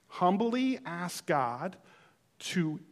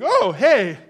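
much louder, high startled "Oh, hey"; surprised greeting; 6 semitones higher, 14 dB louder than usual. Retold or imitated. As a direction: blurt it out as you walk smack into him. Bumps into friend in crowd.

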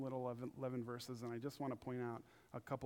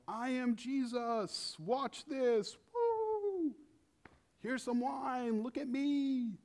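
much higher, louder hammed-up braggart, slow at first; mock show-off; 11 semitones higher, 5 dB louder, much slower than usual. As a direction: show off like a flashy magician.

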